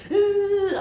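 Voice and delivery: very high, loud grunt right in her face; mock aggressive grunt. Imitating the man getting in her face, loud high grunt. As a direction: push a sharp aggressive "uh" up high. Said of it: "all up in my face".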